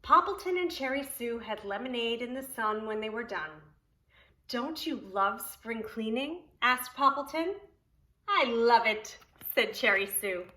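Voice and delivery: lively, sing-song storybook reading; reading to children. Acted. Preschool teacher reading Poppleton to children, storybook voice. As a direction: read it aloud to the preschool class. Reads picture book aloud.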